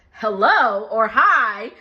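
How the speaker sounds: slow, loud, low correction; pointed greeting. Retold or imitated. Stepdad to guest who skipped greeting, pointed. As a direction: imitate a stepdad stressing the missing manners.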